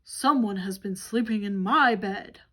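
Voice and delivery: Papa Bear shouting about his bed; great big voice shouted. Acted. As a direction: roar it as the biggest bear. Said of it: "Papa Bear shouted in his great big voice".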